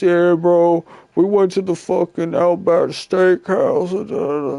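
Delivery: lightly hammed-up oblivious teammate; mock chatty player.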